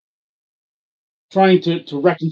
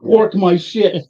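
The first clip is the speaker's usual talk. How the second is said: exasperated outburst; 3 semitones higher, 7 dB louder than usual. Retold or imitated. Husband to wife over a free store bag, loudly exasperated. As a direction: start incredulous, then stretch the last words out loudly.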